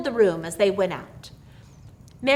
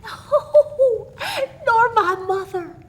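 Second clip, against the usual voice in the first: put-on high, laughing old woman's voice; laughing old aunt, much higher; chuckling; 12 semitones higher, 5 dB louder, slower than usual. A narrator's reading. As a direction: chuckle through the words with glee. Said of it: "The old woman chuckled again".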